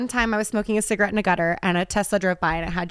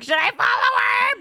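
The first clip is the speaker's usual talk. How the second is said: excited; 13 semitones higher than usual. Retold or imitated. Retold excited car sighting.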